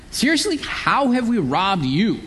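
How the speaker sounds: sarcastic, as if wrongly accused; with attitude. Retold or imitated. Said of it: "hear with a lot of attitude".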